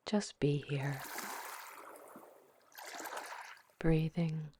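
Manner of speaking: guided meditation. Acted. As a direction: ease the listener into calm, one breath at a time. Leads a guided visualization.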